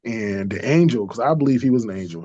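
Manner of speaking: ordinary talk, nothing marked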